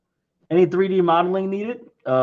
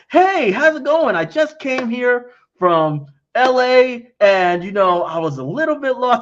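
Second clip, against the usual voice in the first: higher, slow mock-polite tourist; lost tourist; 7 semitones higher, 3 dB louder than usual. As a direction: drawl out a lost visitor's chit-chat. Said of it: "And if you go".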